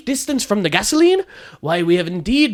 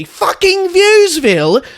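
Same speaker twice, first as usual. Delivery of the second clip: disgusted disbelief; 9 semitones higher, 4 dB louder, much slower than usual. Acted. Disgusted outburst at school name.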